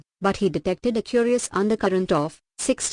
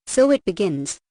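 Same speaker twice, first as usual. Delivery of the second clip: usual speaking voice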